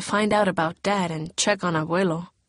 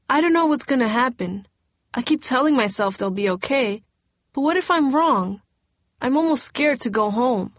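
higher, anxious voice near tears; girl afraid of what waits at home, anxious; scared; 5 semitones higher than usual. A narrator's reading. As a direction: worry spilling out.

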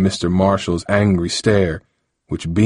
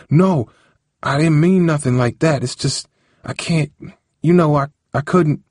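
nervous; 8 semitones higher than usual. Acted. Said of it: "looked nervously at the ground".

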